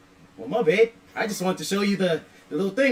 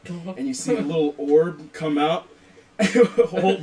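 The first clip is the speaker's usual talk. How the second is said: ordinary delivery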